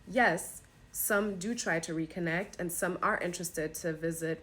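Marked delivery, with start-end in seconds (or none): none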